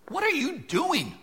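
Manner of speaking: put-on a mother catching her child at it; mom demanding. Preacher imitating a mother asking her kid, very high.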